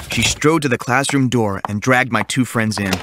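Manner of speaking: plain reading voice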